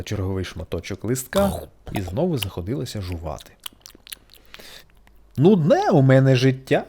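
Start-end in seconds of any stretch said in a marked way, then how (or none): 5.4-6.8 louder, sulky little caterpillar voice; caterpillar's complaint. A narrator's reading. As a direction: grumble it like a bored caterpillar. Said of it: "дійшла висновку Сората". (нудьгуюча гусениця)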